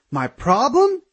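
very high, incredulous repeat with soaring end; escalating outrage. A narrator's reading. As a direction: repeat it in shrieky disbelief, pitch leaping up. Aaron repeats, more outraged.

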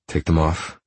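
understated growled order; restrained, commanding growl; low growl. A narrator's reading. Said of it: "I order, my voice a low growl".

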